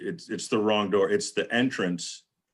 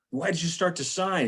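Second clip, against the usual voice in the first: higher, put-on complaining wife voice; voicing Valerie; 8 semitones higher than usual. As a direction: mimic her scolding him, lightly. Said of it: "Valerie's like".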